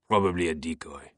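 put-on low, exhaled French-accented remark. A narrator's reading. Captain formulating a plan with his lieutenant, exhaling. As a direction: let it out on a falling breath. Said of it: "Fache exhaled".

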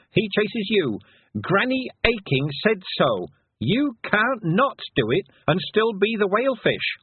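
high-pitched yelling at a whale; insistent, high, scolding yell; indignant yell. A narrator's reading. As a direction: shout orders up at something huge. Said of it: "and yelled at the towering whale".

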